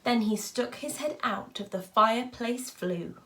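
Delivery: ordinary delivery, nothing marked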